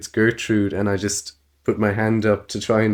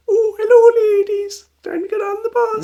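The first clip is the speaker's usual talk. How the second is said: put-on very high falsetto old lady; laughing through a squeaky granny voice; old woman voice; 6 dB louder than usual. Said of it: "a bit of a voice that's similar to the ladies".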